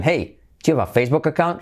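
quick "hey" running straight into rising "account"; question intonation. Shown as a demonstration. Teacher drilling intonation for students, brisk rising "account". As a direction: open with "hey" and lift through "account". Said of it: "Hey, de entonación".

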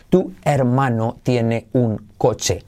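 "tu hermano tiene un coche" slow, word by word; stilted, spaced-out "tu hermano tiene un coche"; separated words. Shown as a demonstration. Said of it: "así, separado".

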